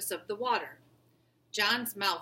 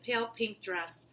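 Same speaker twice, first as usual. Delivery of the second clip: usual speaking voice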